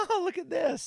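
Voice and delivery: high, loud mock amazement; excited old person. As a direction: play a thrilled old person showing everyone. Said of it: "do old people just love magic".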